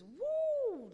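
high, loud yelp; excited whoop. Real, not acted. Preacher whooping at the good word. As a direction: burst out with joy.

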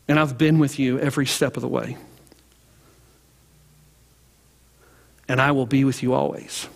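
hushed sermon close. Acted. Preacher closing the story and blessing the congregation, hushed. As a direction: bring it down to a hush.

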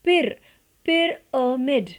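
पिरामिड split पिर then slowly पिरामिड; syllable by syllable, slow; teacher slows पिरामिड down; slow correct form. Shown as a demonstration. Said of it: "इसे पढ़ते हैं या बोलते हैं".

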